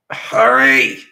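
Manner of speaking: very loud urgent shout. A narrator's reading. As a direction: yell for speed. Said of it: "Gannon Haas shouted".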